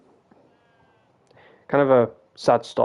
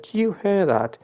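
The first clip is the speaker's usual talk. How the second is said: higher pitched call to the bartenders; retold call across bar; 8 semitones higher than usual. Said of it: "I shouted across to them".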